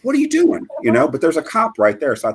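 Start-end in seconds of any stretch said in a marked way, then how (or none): 0.0-0.7 much higher, shocked outburst; the voice jumps high in disbelief; outraged disbelief at a driver wedging in; retold disbelief. Cut off, confronting other driver.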